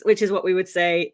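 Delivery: usual speaking voice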